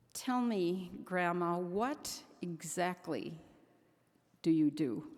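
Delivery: slow, measured mock interrogation; imitating granddaughter. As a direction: play a child solemnly questioning Grandma. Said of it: "she just looked at me and said".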